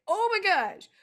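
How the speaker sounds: high, loud oh my gosh; overwhelmed gasp. Retold or imitated. Reacting to her friend's tearful message, high and overwhelmed. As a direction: hit by the moment. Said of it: "And I was like".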